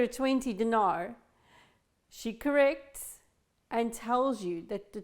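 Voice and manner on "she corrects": ordinary talk, nothing marked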